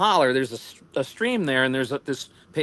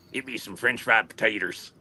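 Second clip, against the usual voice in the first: Sling Blade impression; 6 semitones lower than usual. Quoting Sling Blade to his friend, lower mumbled impression. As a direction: do the Sling Blade guy, laughing into it. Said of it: "that's what I call a sling blade moment".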